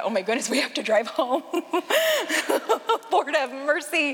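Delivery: mock dismay breaking into laughter; laughing dismay. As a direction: laugh helplessly as you plead for mercy. Laughs at the memory of driving.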